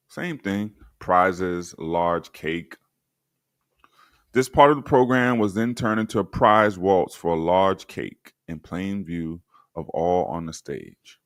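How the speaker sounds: ordinary delivery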